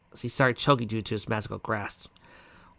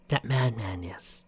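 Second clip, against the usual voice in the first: slow unvoiced whisper; 4 dB quieter, slower than usual. Celestia to the other woman, whispered. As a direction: breathe it out in a whisper.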